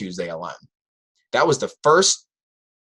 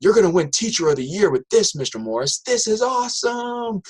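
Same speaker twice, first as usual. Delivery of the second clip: put-on higher, hyped-up teen voice pointing at teacher; excited student; 11 semitones higher than usual. Student pointing at the teacher, praising his pitch, hyped teen voice. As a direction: gush like a kid who loves the idea. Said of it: "he goes".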